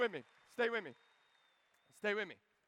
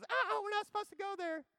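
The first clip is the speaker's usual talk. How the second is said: put-on girly voice; 11 semitones higher, 5 dB quieter than usual. Nala cooing back at Simba in a high girly voice. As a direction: coo it like a smitten girl cub.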